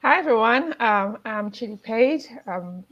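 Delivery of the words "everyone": usual speaking voice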